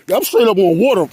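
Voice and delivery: loud, high excited shout; hyped about standing on water. Real, not acted. Excited on the ice.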